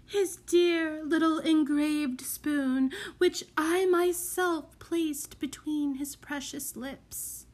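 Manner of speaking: high, slow, yearning lady's voice; wistful murmur. A narrator's reading. Mother recalling her lost baby's spoon, yearning murmur. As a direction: linger over each word, fading away on the last. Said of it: "she murmured".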